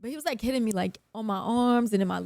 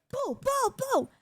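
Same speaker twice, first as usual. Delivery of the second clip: high mock punch noises, boo boo boo; punch sounds; 14 semitones higher, 4 dB quieter, slower than usual. Telling how she punched him, high mock punch noises. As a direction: sound out each punch like a cartoon hit.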